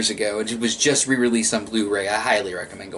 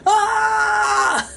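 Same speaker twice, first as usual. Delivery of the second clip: very high, loud yelp of pain; high pained shout from the boys; 3 dB louder than usual. Retold or imitated. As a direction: squeal like a beaten boy. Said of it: "And the guys are".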